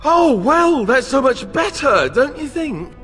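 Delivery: loud, high, biting sarcasm; sarcastic outburst. Acted. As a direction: yell it out with bitter sarcasm. Outraged sarcasm at Morrigan's plan.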